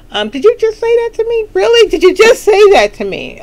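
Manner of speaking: sassy disbelief, hammed-up; mock outrage. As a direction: act scandalized at what he just said. Mock offense at a rude remark.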